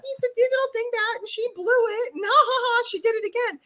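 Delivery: high mocking taunt through laughter; mock heckler laughing. Imitating viewers mocking the digital dingbat, high laughing taunt. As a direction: cackle it like a gloating troll. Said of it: "everybody would go on the internet and go".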